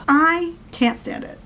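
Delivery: slow, high-pitched exasperation; exasperated. Real, not acted. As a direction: draw it out high, fed up. Rants about makeup comment.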